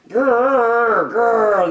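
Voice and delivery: playful growly "grrr, grrr" like tugging puppies; puppy growl. Acted. Puppies tugging a sock, playful mock growl. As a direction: growl it like a puppy in a tug-of-war. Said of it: "they say".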